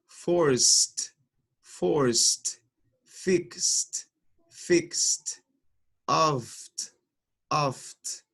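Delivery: each word said twice with a crisp final t; English past tenses. Shown as a demonstration. Teacher drilling verb endings for the class. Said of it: "a pronunciar los verbos".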